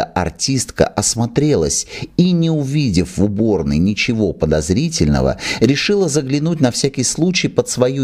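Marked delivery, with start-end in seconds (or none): none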